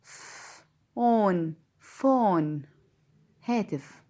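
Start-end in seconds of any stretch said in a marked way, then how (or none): 0.0-1.5 فون stretched very slowly, long f; drawn-out phone. Shown as a demonstration. Teacher stretching the word phone for pupils. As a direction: draw فون out for the learners. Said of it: "أو كلمة إيه كمان؟".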